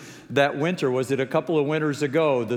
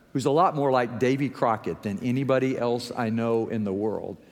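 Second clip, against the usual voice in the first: ordinary delivery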